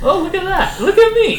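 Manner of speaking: very high, lisping cartoon duck; Daffy Duck voice. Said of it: "Daffy Duck impression".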